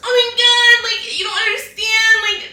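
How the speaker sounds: high, loud crying imitation; high mock sob from the crying roommate; mock wailing roommate. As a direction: whine it like the waterworks. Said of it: "And she's like".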